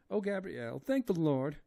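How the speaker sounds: high, loud wailing maid voice; terrified woman begging to die, high wailing; crying Esmeralda. A narrator's reading. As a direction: wail in despair with eyes shut. Esmeralda's voice held.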